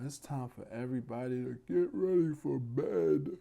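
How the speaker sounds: normal talking voice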